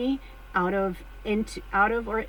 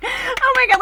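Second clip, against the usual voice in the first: high, loud delighted shriek; imitated friends' shriek; giddy shriek; 8 dB louder than usual. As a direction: shriek like your friends would. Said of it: "would have been like".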